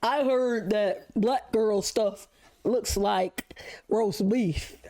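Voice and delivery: slow, odd put-on voice of a clueless boy; drawn-out, slowly, mocking the boy; mock dumb boy voice. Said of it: "he's like".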